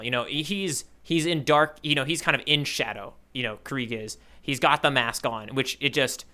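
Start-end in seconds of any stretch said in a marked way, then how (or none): none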